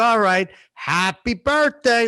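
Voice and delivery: slow, high stretched-out wishes; demonstrated time-killing greeting; padded birthday greeting. Shown as a demonstration. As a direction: stall for time on every word. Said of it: "And I'll look at my watch".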